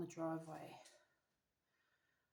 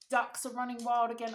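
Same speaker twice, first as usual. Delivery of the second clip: ordinary delivery, nothing marked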